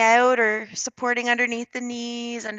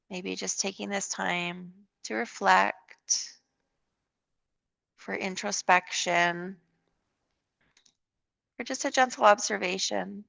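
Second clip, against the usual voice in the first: calm, unhurried yoga guidance with long pauses; guided meditation; 2 semitones lower, slower than usual. Real, not acted. Leads a yoga relaxation.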